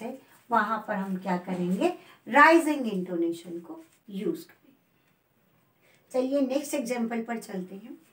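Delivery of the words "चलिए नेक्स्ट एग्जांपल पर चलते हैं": usual speaking voice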